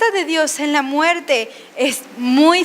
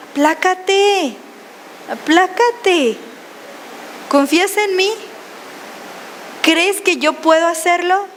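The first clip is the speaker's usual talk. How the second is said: calming; 4 semitones higher, slower than usual. Acted. God answering the anxious believer.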